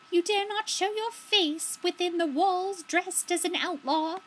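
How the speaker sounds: stately high-pitched warning; character voice. Acted.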